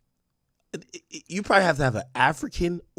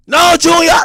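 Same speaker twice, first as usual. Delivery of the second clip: high shouted mom order; mimicking mom yelling; 16 dB louder, slower than usual. Streamer imitating his mom yelling at him, high shout. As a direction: shout the name like a bossy mother. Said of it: "So I'm like".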